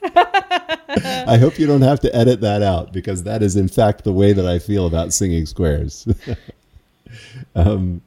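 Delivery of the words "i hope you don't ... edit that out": said through a laugh; giggling while talking. Real, not acted. Laughing about the interview.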